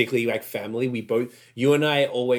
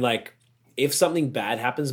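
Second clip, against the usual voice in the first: normal talking voice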